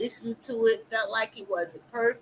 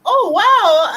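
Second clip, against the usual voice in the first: much higher and louder, squealing delight in a different voice; excited; 12 semitones higher, 11 dB louder than usual. Retold or imitated. Recalling her joy when a creator noticed her first video, high excited squeal. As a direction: replay the thrill of a first viewer noticing you. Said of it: "I got all excited".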